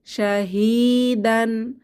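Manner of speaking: শাহিদান said slowly with a long alif after শা; drawn-out শাহিদান, each part clear; stretched alif. Shown as a demonstration. Teacher spells out the Quran word for students, slow and drawn out. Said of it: "এক আলিফ টেনে পড়ব".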